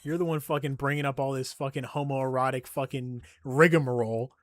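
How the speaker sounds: ordinary talk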